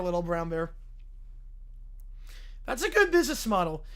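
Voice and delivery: ordinary delivery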